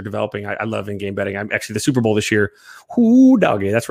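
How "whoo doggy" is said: excited whoop. Real, not acted. Recalling big Super Bowl win.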